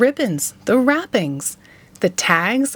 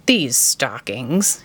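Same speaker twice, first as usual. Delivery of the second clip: low, slow, sly grin on the words; grinning Grinch; 8 semitones lower, much slower than usual. A narrator's reading. Grinch grinning as he eyes the stockings, slow and sly. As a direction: savor it like a greedy villain. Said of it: "he grinned".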